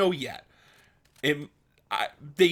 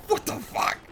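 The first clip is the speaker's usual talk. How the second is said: shocked; 12 semitones higher than usual. Retold or imitated. Shocked reaction to the community.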